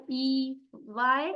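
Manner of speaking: ई, वाई, आई spelled out very slowly; very slow letters. Shown as a demonstration. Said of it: "वाई और आई लगा के ई".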